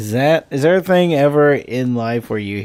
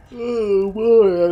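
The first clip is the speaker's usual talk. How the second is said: yawning; 10 semitones higher, 3 dB louder than usual. Real, not acted. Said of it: "I'm yawning already".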